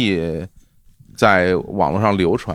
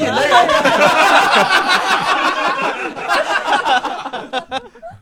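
long, high burst of laughter; real laughing fit; 3 dB louder than usual. Real, not acted. Host cracking up at his own awkward introduction, real laughing fit.

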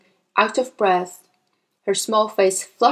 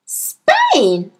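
put-on loud, high child's echo; stretched, incredulous little-girl cry; dismayed cry; 5 semitones higher, 5 dB louder than usual. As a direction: cry it out in disbelief. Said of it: "Matilda cried".

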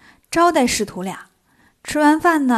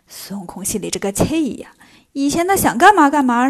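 ordinary delivery

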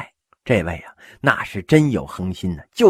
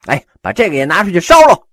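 disdainful order; 8 semitones higher, 9 dB louder, faster than usual. Acted. Scornful order to burn stool.

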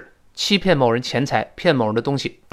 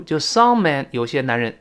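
ordinary delivery

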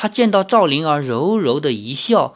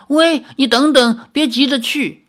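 calling after her; 10 semitones higher, 4 dB louder than usual. Acted. Calling after departing Yueru.

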